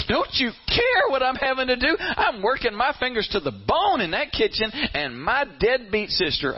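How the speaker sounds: high, hammed-up aggrieved plea; playing the victim. Martha protesting to Jesus about her kitchen work, wailing victim. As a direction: complain like an overworked martyr, pitched high. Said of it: "she is a victim".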